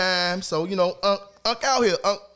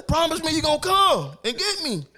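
mock pleading; 6 semitones higher than usual. Retold plea to his brother.

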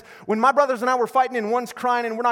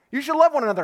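loud love verse; 7 semitones higher, 6 dB louder, faster than usual. Acted. Pastor proclaiming the verse to the congregation, loud. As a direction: belt the verse out high and loud.

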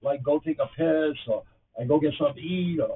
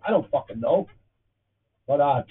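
normal talking voice

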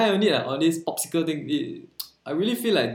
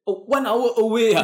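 surprised; 6 semitones higher, 6 dB louder than usual. Real, not acted. Reacts to distant airport.

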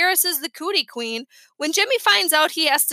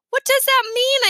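high, urgent demand; alarmed demand; 10 semitones higher, 5 dB louder than usual. A narrator's reading. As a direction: press him hard for an answer. Demanding an answer.

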